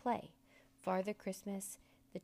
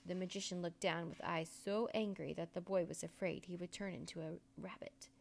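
plain reading voice

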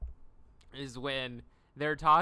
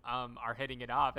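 ordinary talk, nothing marked

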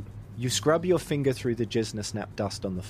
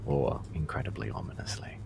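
ominous whisper; 7 semitones lower, 7 dB quieter than usual. Acted. GM's ominous aside.

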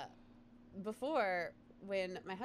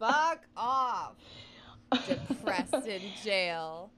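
loud, drawn-out sneer; scornful; 7 dB louder, much slower than usual. Real, not acted. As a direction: sneer it slowly, loudly. Scorn at his depression.

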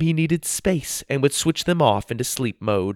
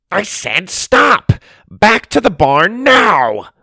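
much higher and louder, slow furious command; angry shout; 9 semitones higher, 7 dB louder, slower than usual. A narrator's reading. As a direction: yell them back to the barn. Robots ignore him, he shouts again.

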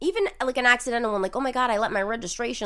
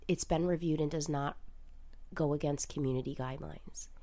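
close-mic whisper; 6 semitones lower, 9 dB quieter than usual. Real, not acted. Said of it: "get close to the mic and whisper".

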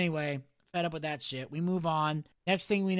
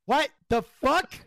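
higher, louder what the fuck; stunned disbelief; 6 semitones higher, 7 dB louder than usual. Real, not acted. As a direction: react as if you can't believe your eyes. Shocked at Bodie's outfit.

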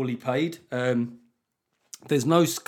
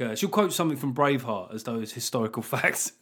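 ordinary talk

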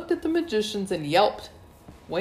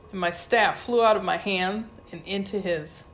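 usual speaking voice

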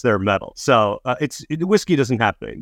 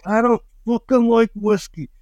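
higher mock complaint, a put-on voice; retold grumpy protest; 9 semitones higher than usual. Comedian retelling fans' whiskey shots, mock grumble. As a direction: whine that you hate whiskey. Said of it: "So I was like".

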